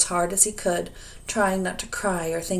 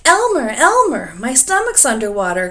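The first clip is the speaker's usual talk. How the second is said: high, loud alarmed cry; cried out; 5 semitones higher, 8 dB louder than usual. A narrator's reading. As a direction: call out on waking in alarm. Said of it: "cried the dragon".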